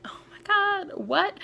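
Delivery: high-pitched, incredulous outburst; shocked. Retold or imitated. Reacting to drunk fifteen-year-olds, shocked. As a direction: leap up high in disbelief.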